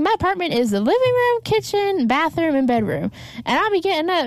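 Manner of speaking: hint of a Kentucky wife. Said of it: "She's like".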